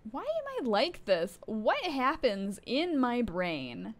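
exasperated. Real, not acted. Player berating own brain after a mistake, exasperated. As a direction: wail it at yourself in frustration.

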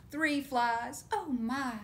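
ordinary delivery, nothing marked

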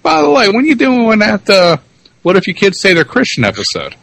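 lightly voiced, high and breezy; imitating an offhand reminder; mock casual reminder. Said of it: "Or you're like".

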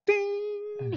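a high sung ding like a bell; high chime ding. Retold or imitated. To his friend when the idea strikes, a high sung ding. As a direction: chime it out, a light bulb going on.

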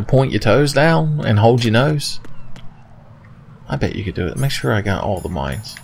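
normal talking voice

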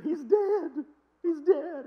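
high, loud, wailing mock sob; mock wailing grief. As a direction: ham up an old lady's grief. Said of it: "And then she said".

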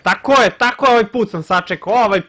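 loud, high victory shout; triumphant gloating. Real, not acted. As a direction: crow about the win. Gloating after successful play.

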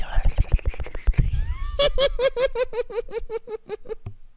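long, stuttering whispery laugh; creepy laugh. Pretend. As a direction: let a weird high laugh trail out. Spooky whispered bit to himself.

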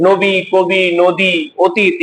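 ordinary delivery

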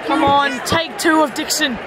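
ordinary talk, nothing marked

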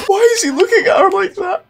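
speaking through high shrieking laughter; laughing. Real, not acted. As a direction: barely get the words out for laughing. Hysterical laughing at game scene.

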